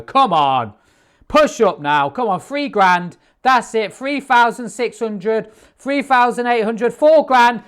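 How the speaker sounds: rising excited cheering. Real, not acted. Cheering trade to target.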